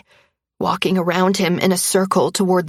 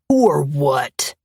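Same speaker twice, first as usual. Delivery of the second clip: sneer; 4 semitones lower than usual. A narrator's reading. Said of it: "Aaron sneers".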